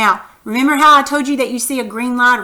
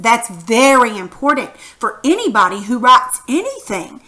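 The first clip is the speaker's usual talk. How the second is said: ordinary delivery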